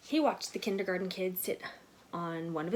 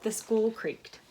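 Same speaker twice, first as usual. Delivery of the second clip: ordinary delivery, nothing marked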